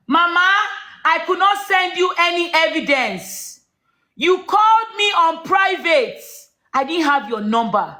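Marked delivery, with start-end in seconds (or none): 0.1-6.2 angry shout. Real, not acted. To Mama on the live call, a furious high shout. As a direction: yell the accusation at her, furious.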